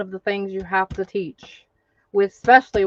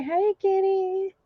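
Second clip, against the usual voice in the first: put-on high, slow baby voice; 10 semitones higher, slower than usual. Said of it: "You say".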